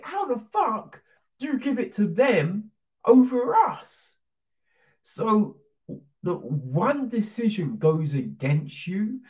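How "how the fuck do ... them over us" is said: rising, high outraged complaint; protesting rival team. Retold or imitated. Rival team protesting to the stewards, high outrage. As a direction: whine it up to a squeak on us.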